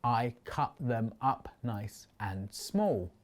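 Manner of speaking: slow, evenly spaced "I cut them up nice and small"; syllable by syllable. Shown as a demonstration. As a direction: count out "I cut them up nice and small" one word at a time. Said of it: "That's seven syllables".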